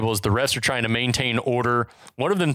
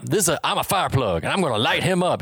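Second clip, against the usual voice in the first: lightly put-on Southern accent, a bit higher; a hint of a Southern tough guy boasting; 4 semitones higher, faster than usual. Host imitates Bosco's trash talk, light Southern accent. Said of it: "He has, like, a Southern accent".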